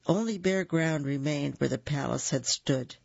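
ordinary delivery, nothing marked

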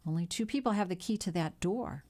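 ordinary delivery, nothing marked

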